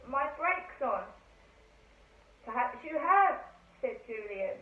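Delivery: lively read-aloud with light character voices; story narration. A narrator's reading. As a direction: tell the children's adventure steadily, page by page. Reads Famous Five chapter aloud.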